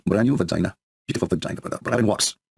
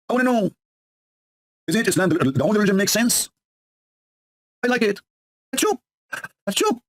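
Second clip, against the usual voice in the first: mock naive believer, high pitched; sarcastic high voice; 8 semitones higher than usual. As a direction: play dumb in a squeaky voice.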